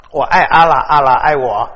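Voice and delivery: 我爱阿拉 and 阿拉爱我 said out loud with confidence; bold declaration. Acted. Pastor voicing a Sufi boldly declaring love for Allah, loud. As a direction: proclaim it proudly. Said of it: "但是苏菲派可以这样说".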